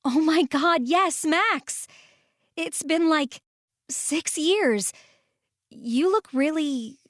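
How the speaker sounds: high, delighted gasp of recognition; thrilled to see an old friend; excited surprise. Acted. Reunion with childhood friend Max.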